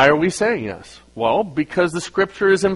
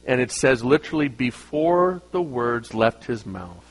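ordinary talk, nothing marked

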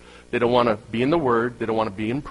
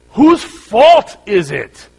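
mock outrage; 10 semitones higher, 12 dB louder, much slower than usual. Mock outraged question about his car.

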